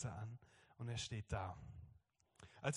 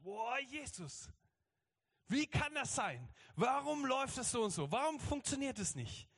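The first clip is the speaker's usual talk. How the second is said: put-on childlike complaining; 10 semitones higher than usual. Believer complains up to Jesus, high childish mock protest. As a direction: whine upward like an indignant small child. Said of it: "sind wir selber auch mit Jesus so".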